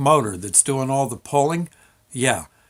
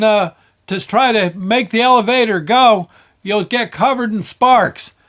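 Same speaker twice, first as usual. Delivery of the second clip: shouted warning; 7 semitones higher, 6 dB louder than usual. Retold or imitated.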